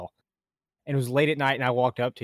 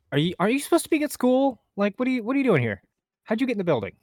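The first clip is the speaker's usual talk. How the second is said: high teasing voice; 8 semitones higher, faster than usual. Retold or imitated. Supervisor teasing him as a kid.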